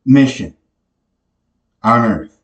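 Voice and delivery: ordinary delivery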